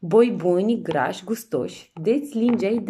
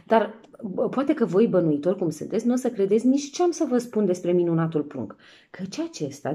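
usual speaking voice

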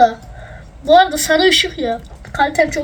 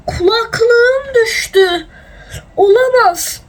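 dismayed cry; 7 semitones higher, 4 dB louder, much slower than usual. Real, not acted. Headphones fall off mid-recording.

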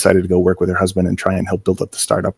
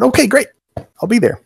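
higher, eager yes on the phone; eager high-pitched reply. Retold or imitated. Young artist answers job offer call, eagerly higher. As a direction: squeak out an overeager okay, great. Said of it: "I said".

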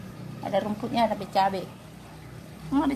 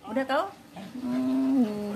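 usual speaking voice

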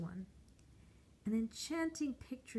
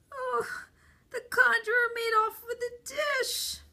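much higher, loud tearful wail; crying; 9 dB louder than usual. A narrator's reading. The weeping boy answering passersby about his lost dish. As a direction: sob it out like a heartbroken child. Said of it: "why I was crying".

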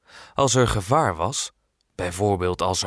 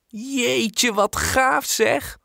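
much higher and slow, drawn-out delight; excited exclamation; 13 semitones higher, much slower than usual. Acted. Girl thrilled by the knights' party, slow high excited cry. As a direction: stretch the wonder out, very high. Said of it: "roept Kolletje".

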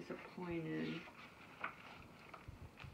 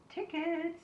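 higher, bright chirp; chirpy; 6 dB louder than usual. A narrator's reading. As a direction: chirp it like a cheery ticket lady. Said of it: "chirped the lady at the door".

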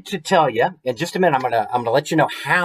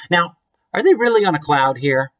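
ordinary delivery, nothing marked

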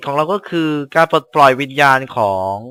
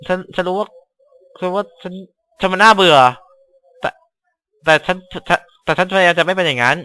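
put-on higher, halting, apologetic little girl voice; timid pleading girl; 5 semitones higher than usual. Schoolgirl apologizing to her friend, timid and stammering.

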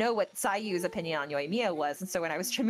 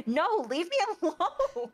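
higher, slow and whiny; Sayu's voice; 10 semitones higher, slower than usual. Retold or imitated. Sayu refusing Yoimiya, slow high whine. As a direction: whine it like a sleepy kid. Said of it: "And Sayu's like".

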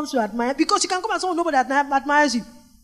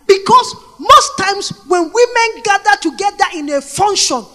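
raised, driving preacher voice; fervent preaching; 7 semitones higher, 8 dB louder than usual. Acted. Preacher urging the women about gatherings. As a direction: push it out to the crowd.

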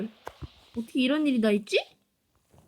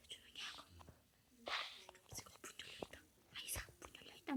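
hushed whisper, creeping in; sneaking whisper; 5 semitones lower, 27 dB quieter, faster than usual. Real, not acted. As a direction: whisper so the sleeping friend won't wake. 친구 집에 몰래 들어감. (몰래 들어가며 속삭이기)

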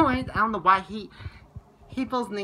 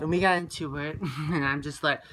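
ordinary talk

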